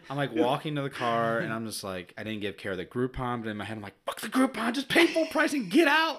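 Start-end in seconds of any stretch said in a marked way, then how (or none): 4.1-6.2 high, loud panicked urging; hammed-up desperate shout to leave; frantic inner rant. Dad mentally urging wife out of the restaurant, frantic yell.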